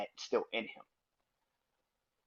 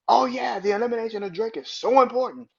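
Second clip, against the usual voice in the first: high, loud sarcastic mimicry; 11 semitones higher, 8 dB louder than usual. Vlogger mocking [name]'s podcast claim, loud and sarcastic. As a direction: parody his smug line. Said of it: "you think 'cause you about to win".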